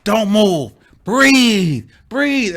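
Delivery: loud, high, urgent orders; mock coaching commands. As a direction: imitate a helper shouting instructions. Imitates friend holding them down.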